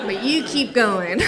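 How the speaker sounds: loud, slow therapist prompt; Tara, urging client on. Retold or imitated. Therapist waves away her disaster, loud drawn-out encouragement. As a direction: urge the client on, stretched out and loud. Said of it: "And she'd go".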